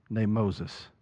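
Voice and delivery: ordinary talk, nothing marked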